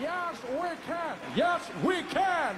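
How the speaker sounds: high, mounting rallying cry; rousing slogan. Acted. Mandela cheering Obama on, high triumphant cry. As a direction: build the chant at a high pitch.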